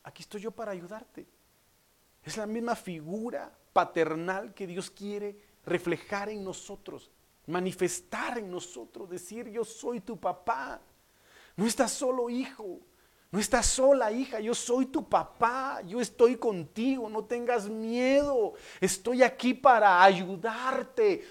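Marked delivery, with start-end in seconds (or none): 0.0-1.3 soft, hushed reassurance; tender, quiet daddy voice; comforting father. Retold or imitated. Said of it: "y los conforta y le dice". 9.5-21.2 higher, drawn-out fatherly declaration; emphatic, slow-building promise to a child; impassioned preaching. Acted.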